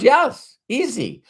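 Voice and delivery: high-pitched imitation of a Middle Eastern speaker; Middle Eastern accent. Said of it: "people that can't speak English".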